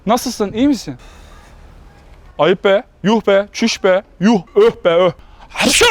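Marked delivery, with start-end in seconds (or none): 5.5-5.8 sneezing. Acted. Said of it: "Hapşurdum ya".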